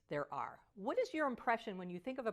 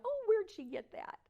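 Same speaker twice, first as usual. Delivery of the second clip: amused surprise; 11 semitones higher, 3 dB louder than usual. Retold or imitated. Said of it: "we're going".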